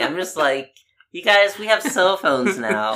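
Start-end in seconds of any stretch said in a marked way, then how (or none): 1.1-2.9 higher-pitched plea to the family; retold exasperated appeal; mock exasperation. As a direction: remind them as if it's obvious, pitched up. Quotes own exasperated plea to family.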